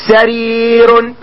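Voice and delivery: سرير stretched very slowly; slow model word. Shown as a demonstration. Teacher giving the singular example, very slow and drawn out. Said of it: "بحال اش؟".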